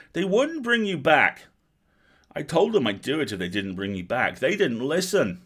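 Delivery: high, loud, insistent outburst; loud, upset protest. A narrator's reading. Girl justifying herself to the wizard, loudly upset. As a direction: cry out in protest, stretching the words.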